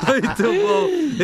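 muito bom entre risos, agudo e alto; rindo. Real, not acted. Reaction after joke. (rindo depois da piada)

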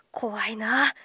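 breathy whisper, spooked; whispered aside. Real, not acted. Host spooked by the mystery, whispered aside. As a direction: whisper it to yourself, a little unnerved.

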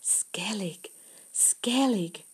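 repeated breathy whisper. A narrator's reading. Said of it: "she whispered".

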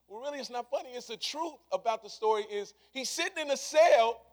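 ordinary talk

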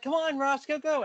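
egging a friend on, bouncy and high; mock cheering. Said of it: "And, like".

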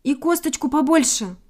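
high-pitched little bird call; calling after someone. A narrator's reading. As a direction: call out to a friend running off. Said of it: "закричала ему вдогонку Синичка".

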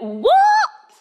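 retold squeal of shock; shocked what. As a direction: jolt of disbelief at the bill. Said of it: "I went".

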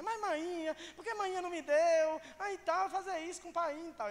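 high-pitched Northeastern Brazilian pleading; imitated Northeastern Brazilian coaxing; Northeastern Brazilian wheedling. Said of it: "naquele jeito nordestino".

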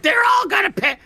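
high squeaky shouted threat; tiny villain rant. Acted. Little alchemist vowing revenge in his lab, high and shouting. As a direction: shriek it like a petty mad genius.

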